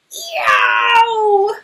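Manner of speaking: high, loud cry of a bear in trouble; pained yowl. Acted. Papa Bear crying out in the story, loud yowl. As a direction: yelp as if falling.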